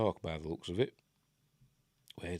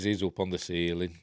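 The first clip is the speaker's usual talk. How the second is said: ordinary talk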